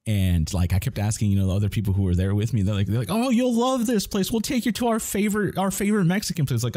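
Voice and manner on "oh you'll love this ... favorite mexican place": high, bright mock-cheerful voice of the friends; mock them as eager hosts, pitched high; imitating eager friends. Colleagues recommending place, acted quote.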